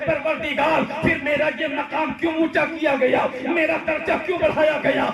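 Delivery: high, urgent cry to the Lord; calling out. Acted. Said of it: "وہ پکار کر عرض کرتا ہے".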